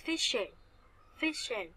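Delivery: "Fiction" stressed on FIC, falling on -tion; stress before the suffix. Shown as a demonstration. Teacher explaining suffix stress to students, "Fiction" stressed on FIC. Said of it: "các bạn sẽ nhấn trước nó".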